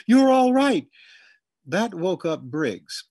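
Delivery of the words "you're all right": high, loud reassuring shout; shouting to calm. A narrator's reading. Herman shouts to calm his mother, loud high. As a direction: yell the comfort over her panic. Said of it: "They exchanged shout for shout".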